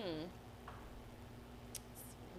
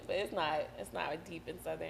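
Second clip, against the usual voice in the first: ordinary talk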